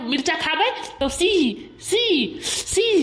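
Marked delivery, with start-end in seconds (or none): 1.8-3.0 sharp hissing see sound, higher; chilli hiss. Shown as a demonstration. Kid shows the teacher the chilli-burn hiss. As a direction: hiss like your mouth is burning from chilli. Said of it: "सी ऐसे आवाज निकली हमारे मुंह से".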